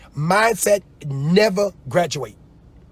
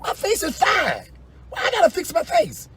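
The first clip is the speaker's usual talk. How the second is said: mock indignant; 14 semitones higher than usual. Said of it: "I'm like".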